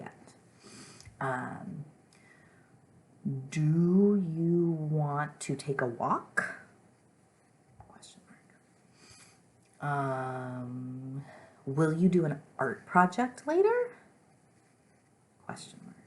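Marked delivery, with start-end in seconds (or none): 3.5-6.5 slow, loud question lifting on "walk"; exaggerated teacher's question rising on "walk"; questioning rise. Shown as a demonstration. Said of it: "your voice goes up a little bit at the end". 11.7-14.0 loud question climbing high on "later"; questioning rise. Shown as a demonstration. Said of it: "your voice goes up a little bit at the end".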